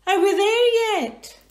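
very high, loud, slow whine; high slow whining child.

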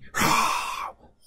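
loud sharp gasp of mock fright; startled gasp. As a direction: gasp as if the dinosaur jumped out. Host startled by puppet.

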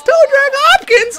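"Toe drag Hopkins" shouted very high and loud; ecstatic shout. Real, not acted. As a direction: yell the nickname at the top of your lungs. Chanting player nickname after goal.

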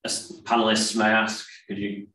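ordinary talk

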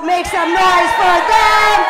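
loud, slow, sung-out call to cheer; crowd hype shout. Real, not acted. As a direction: drag out the call for noise. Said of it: "hyping crowd".

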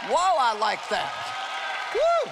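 a real whoa, then a high whoop; delighted whoop. Real, not acted. Preacher thrilled by the verse she just read, whooping. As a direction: burst out with joy at the verse.

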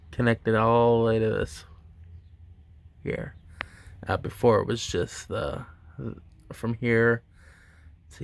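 lip pulled aside talking; showing braces while speaking; finger pulling lip. Real, not acted.

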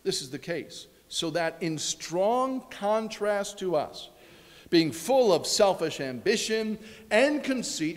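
ordinary talk, nothing marked

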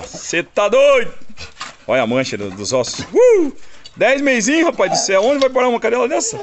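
high, loud astonished exclaim; amazed. Real, not acted. Owner marvels at his young dog, high and loud. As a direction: marvel at the pup's strength.

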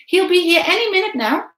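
put-on high, loud boy's voice; boy insisting. A narrator's reading. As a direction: insist it is true. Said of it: "I said".

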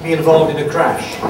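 ordinary delivery

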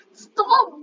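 high loud stop; yelled stop. Real, not acted. At the friend busy Instagramming, high loud stop. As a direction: snap at him to stop.

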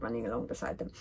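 usual speaking voice

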